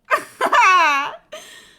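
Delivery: high, loud burst of laughter. Real, not acted. Streamer laughing at her own plot guess, loud and high. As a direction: cackle with glee.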